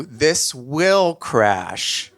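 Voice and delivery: very slow and deliberate; understated dread; grim certainty. Retold or imitated. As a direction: settle into doom word by word. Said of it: "subsequently turned into".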